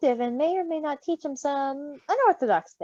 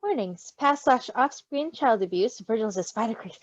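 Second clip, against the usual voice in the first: ordinary talk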